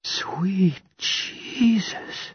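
slow, high whisper; drawn-out hushed exclamation of pleasure; low voice. A narrator's reading. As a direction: breathe it out slowly, half whispered. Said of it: "Claire says in a low voice".

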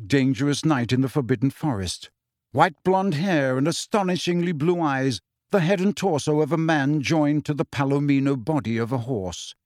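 ordinary delivery

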